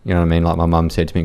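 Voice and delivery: ordinary talk, nothing marked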